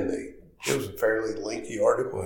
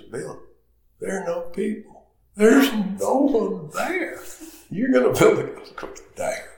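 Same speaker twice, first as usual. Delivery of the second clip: voicing Dick; 13 semitones higher, 6 dB louder than usual. Retelling Dick's phone call.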